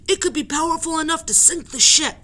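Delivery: high, very loud order; commanding shout. Acted. Captain shouts at the crowd, high and very loud. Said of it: "he shouted".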